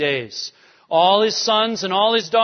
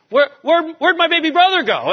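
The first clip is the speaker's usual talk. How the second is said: high, loud and hurried panicked wail; panicked brother calling after the lost boy; frantic panic; 12 semitones higher, 7 dB louder than usual. Acted. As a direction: search desperately out loud. Reuben frantically searching.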